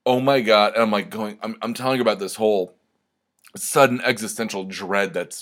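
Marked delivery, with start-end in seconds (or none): 0.0-0.7 louder, higher mock exclamation; playing his friend reacting in horror; mock gasp of dismay. Friend on the phone reacting to his story, hammed-up dismay. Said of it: "Deb was on the phone with me going".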